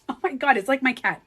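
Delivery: normal talking voice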